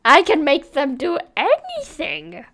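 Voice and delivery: a hint of a gleeful villain; mad scientist. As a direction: gloat as if pulling the strings. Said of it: "I am evil".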